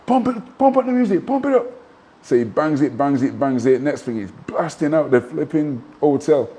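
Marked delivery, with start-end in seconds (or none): 0.1-1.6 high yelled hype call; excited shout. Retold or imitated. Puff hyping the DJ at four in the morning, high excited yell. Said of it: "And [name]'s going".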